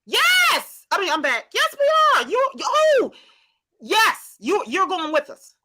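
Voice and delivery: high, loud bursts of excitement; thrilled, high-pitched outburst; excited yes. Real, not acted. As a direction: squeal out an eager confirmation. Answering excitedly about London trip.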